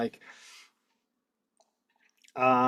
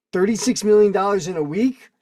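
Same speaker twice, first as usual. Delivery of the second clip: incredulous; 5 semitones higher, 5 dB louder than usual. Real, not acted. Shocked at the sum.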